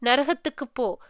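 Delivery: plain reading voice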